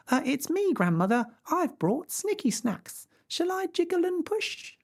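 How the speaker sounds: put-on high, bright little girl answer. A narrator's reading. Girl answering the wolf, high and eager. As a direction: chirp it like a small girl.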